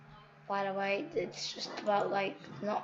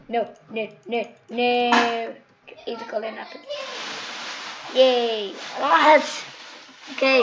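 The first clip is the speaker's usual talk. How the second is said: loud, higher gamer shrieks; watching the match, loud excited shrieks; excited yelling; 3 semitones higher, 14 dB louder than usual. Real, not acted. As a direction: yell at the screen in excitement. Excited gameplay reactions.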